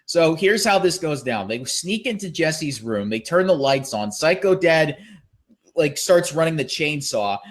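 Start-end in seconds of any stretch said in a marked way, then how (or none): none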